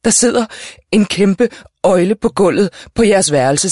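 high, loud and slow alarmed outburst; mother's alarmed, gasped announcement; gasping alarm. A narrator's reading. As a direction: push each phrase out, alarmed and short of breath. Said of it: "hev efter vejret".

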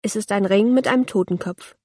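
ordinary delivery, nothing marked

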